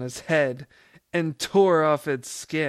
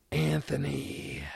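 dark whisper behind you; 4 dB quieter than usual. A narrator's reading. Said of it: "the thing hissed".

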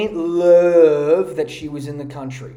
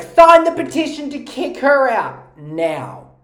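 raised, loud, drawn-out crowd demand; slow, high mock-indignant cry; mock outrage; 7 semitones higher, 6 dB louder than usual. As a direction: imitate an outraged online mob. Voicing outraged crowd on Twitter.

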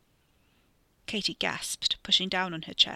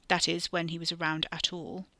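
ordinary delivery

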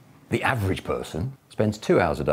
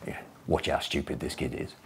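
hushed aside; 4 semitones lower, 9 dB quieter than usual. Retold or imitated. Barber's aside to customer.

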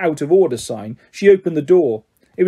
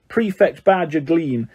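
ordinary delivery, nothing marked

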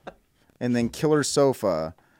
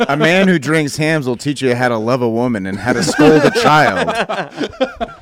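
laughing; 4 semitones higher, 8 dB louder than usual. Acted. Mock endorsement, laughing.

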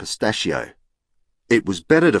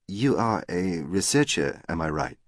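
put-on French accent; 5 dB quieter than usual. A narrator's reading. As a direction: play a Frenchwoman speaking careful English. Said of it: "in an attractive French accent".

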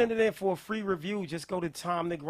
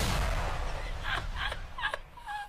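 high shrieking laugh. Real, not acted. Host cracking up at lyric.

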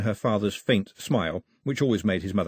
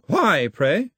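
put-on haughty old lady; 7 semitones higher, 5 dB louder than usual. Grandmother's haughty question.

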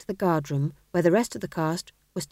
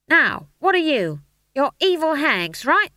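high, loud, forceful prompting; rally them sharply; drill sergeant briefing; 8 semitones higher, 6 dB louder than usual. A narrator's reading. Stage manager drilling trembling witches, loud and forceful.

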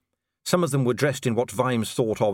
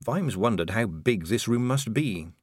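usual speaking voice

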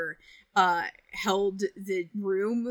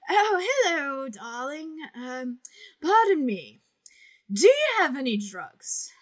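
high, slow, theatrical grande dame purr; a grand theatrical diva asking for drugs; swooping high darling voice of a stage diva; Patricia Quinn impression; 6 semitones higher, 6 dB louder than usual. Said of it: "my favorite impression to do of her".

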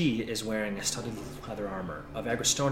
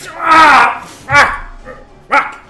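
loud, high yelps of agony; wounded howl and curses; cries of pain; 19 dB louder, much slower than usual. Acted. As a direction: swear explosively as you hit the ground. Half-orc dropped while injured.

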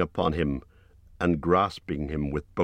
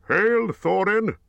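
much higher, slow, formal greeting; Bard the bowman; 5 dB louder, slower than usual. A narrator's reading. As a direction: raise the voice high for a grave herald-like Bard. Narrator voices Bard.

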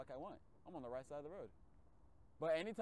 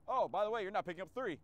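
app voice; 7 semitones higher, 10 dB louder than usual. Retold or imitated. Mimicking the delivery app.

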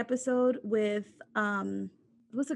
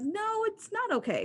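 high indignant pushback; no, said high with disbelief; 10 semitones higher than usual. Real, not acted. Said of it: "indignant reply".

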